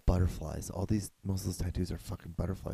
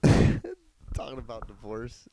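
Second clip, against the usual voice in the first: laughing; 5 semitones higher, 7 dB louder, much slower than usual. Real, not acted. Laughing at the pastor clip.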